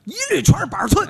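punchline shouted out. Acted. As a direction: bellow the punchline.

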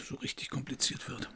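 trailing into a hush; soft whisper. Acted. Detective flirts back with Jeanne, whispering. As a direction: let it fade to a whisper.

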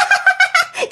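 high cackling laugh of a spirit; ghostly laugh. Retold or imitated. Ghost in the neighbor mocking the family, cackling. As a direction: cackle in triumph. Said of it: "giọng đàn ông ồn ồn".

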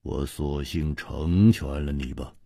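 lightly voiced cold villain, low and quiet; 冷酷的黑袍客. A narrator's reading. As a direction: pass sentence in a low, restrained voice. Villain coldly passing a death sentence. (低沉冷酷地说)

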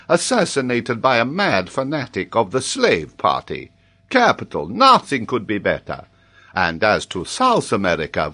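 put-on animated older scholar, swooping pitch; theatrical geographer in full flow; excitable professor. Voices Paganel, excited geographer.

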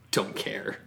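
breathy whispered aside. Real, not acted. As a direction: whisper it under your breath.

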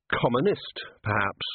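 usual speaking voice

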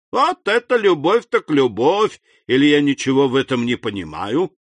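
put-on loud, high, swooping drunk voice; drunken cry. A narrator's reading. Drunk Caderousse marvelling at love, noisy and tipsy. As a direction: exclaim like a merry drunkard. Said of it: "закричал Кадрус пьяным голосом".

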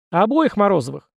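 high-pitched call from the crowd; echoing shout. A narrator's reading. Second fan echoing the first from the crowd, calling out. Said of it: "вторил этому голосу другой".

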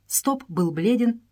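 plain reading voice

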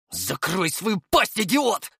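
slow, high, strained hissed insult; seething through gritted teeth; angry hiss. A narrator's reading. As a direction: hiss it out, stretching the words. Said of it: "прошипел Тёмыч".

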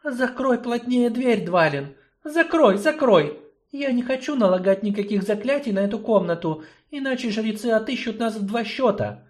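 high, confiding sorceress; secretive sorceress. Acted. Sorceress confides in gnome, high voice. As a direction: tell a secret.

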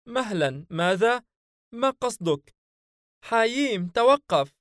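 high, anxious companion voice pleading with his master; a nervous spirit begging for reassurance, voiced high; worried jinn sidekick.

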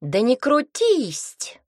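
a fussy goblin telling someone off; whistling goblin voice. A narrator's reading. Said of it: "Разговаривал гоблин с лёгким посвистыванием".

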